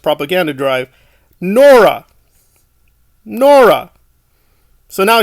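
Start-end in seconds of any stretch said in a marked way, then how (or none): 1.4-2.1 a loud, pointed "Nora."; emphatic name call. Real, not acted. Vlogger calls out Nora's name, loud and mocking. 3.2-3.9 a loud, pointed "Nora."; emphatic name call. Real, not acted. Vlogger calls out Nora's name, loud and mocking.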